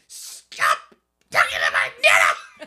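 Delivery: very loud, hammed-up angry shout; Cheryl, pissed off. Podcaster imitating Cheryl defending Nana, pissed-off shout. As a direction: yell it hard, cutting them off. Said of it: "Cheryl also gets pissed".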